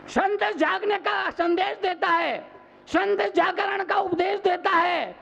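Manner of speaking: high, ringing sermon delivery; full-force preaching. Real, not acted. Monk warning that vices steal faith's wealth, full-force preaching. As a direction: sustain a high, urgent exhortation, stretching the final words.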